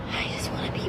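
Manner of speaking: whispered, worn out; exasperated whisper. Real, not acted. Tired mom whispering her wish for a moment alone. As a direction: breathe it out like you're at the end of your rope. Exhausted mom wants a break.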